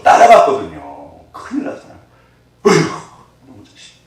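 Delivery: usual speaking voice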